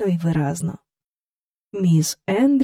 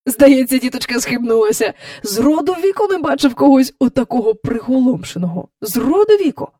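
a gruff-free, lively cabbie aside, louder; taxi driver voice; 5 semitones higher, 5 dB louder than usual. A narrator's reading. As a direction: marvel at the strange old lady.

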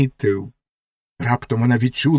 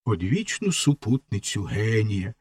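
ordinary delivery, nothing marked

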